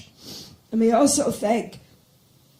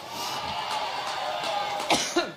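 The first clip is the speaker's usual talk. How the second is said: dry hacking coughs; coughing fit; 7 dB quieter than usual. Real, not acted. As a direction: cough hard, stopping the speech. Coughing at the podium.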